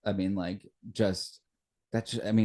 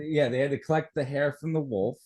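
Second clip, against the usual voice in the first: ordinary talk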